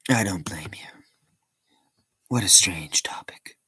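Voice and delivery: soft whispered aside; whispering. Real, not acted. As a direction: whisper it to yourself. Muttered aside to a silent chat.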